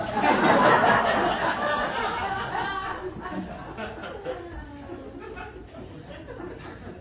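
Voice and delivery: big delighted laugh breaking up high; helpless long laugh. Real, not acted. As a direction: crack up at the punchline. Punchline of his river story.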